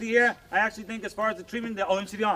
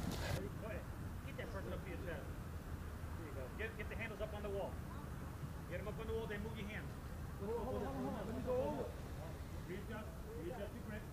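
quiet coaching; 17 dB quieter than usual. Real, not acted. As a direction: murmur directions to the team.

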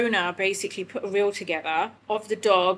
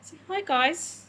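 talking to the dog; 9 semitones higher than usual. Real, not acted. Showing the dog to camera.

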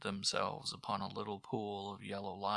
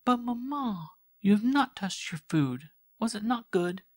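put-on high, louder worried girl's voice; fussing daughter; 13 semitones higher, 9 dB louder than usual. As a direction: coax mother to eat. Girl fusses over mother's food.

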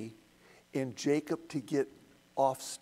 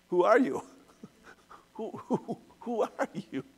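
put-on confused old Isaac; 6 semitones higher than usual. Teacher voices Isaac, a confused old father asking his son. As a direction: ask it like a bewildered old man.

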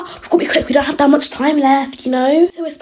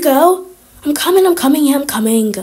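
usual speaking voice

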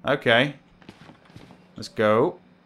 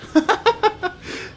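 high-pitched real laugh; burst of laughter. Real, not acted. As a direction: laugh helplessly at the gruesome sight. Laughs at headless body.

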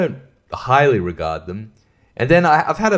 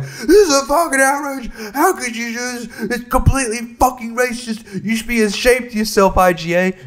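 high-pitched hammed-up rant of moral outrage; high mock-furious yelling; mock outraged yelling; 10 semitones higher than usual. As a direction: rant like a self-righteous crank in a shop. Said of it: "yelling like a crazy person".

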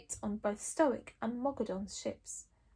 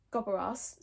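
ordinary delivery, nothing marked

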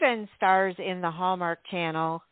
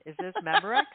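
much higher, rising playful question; mock commercial line; 12 semitones higher, faster than usual. Shown as a demonstration. Host teasing co-host with an old ad slogan, high and playful. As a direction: toss Memorex up high like an old ad. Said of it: "Remember that?".

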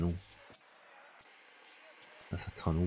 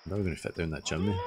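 ordinary talk

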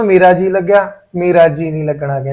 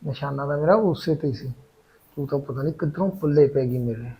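scheming aside; 3 semitones lower, 10 dB quieter than usual. Acted. Husband scheming aside after wife leaves.

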